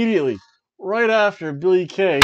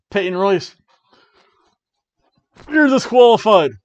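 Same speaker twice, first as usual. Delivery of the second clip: loud, higher referee ruling; referee's call; 4 semitones higher, 9 dB louder than usual. Retold or imitated. Referee ruling against Peyton Royce, loud call. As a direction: call out the disqualification like an angry ref.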